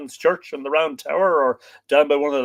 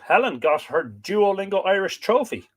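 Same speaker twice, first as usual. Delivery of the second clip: ordinary talk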